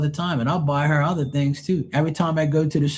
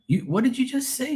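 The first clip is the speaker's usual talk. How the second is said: disbelief; 9 semitones higher, 4 dB quieter than usual. Retold or imitated. Disbelief at caller.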